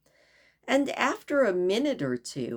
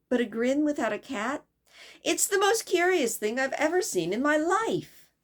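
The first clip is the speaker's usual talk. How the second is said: put-on light, high girlish voice, politely asking; a young girl asking the way, eager; lightly voiced curious girl; Alice's voice; 7 semitones higher than usual. Alice marvelling.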